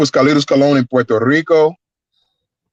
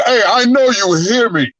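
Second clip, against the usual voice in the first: slow, higher call across the hall; giant's call; 7 semitones higher, slower than usual. Retold or imitated. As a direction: call him back slowly and firmly, a little higher. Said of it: "He said".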